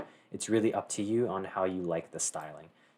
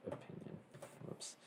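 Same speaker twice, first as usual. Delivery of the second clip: whispered to self; 20 dB quieter than usual. Real, not acted. Muttering while typing.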